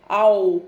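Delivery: आओ said loud, low and open, mouth rounding wide; loud teaching demo. Shown as a demonstration. Teacher contrasting ow with ou for children, loud demo. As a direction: show the ow sound of आओ loud and clear. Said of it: "look at my mouth".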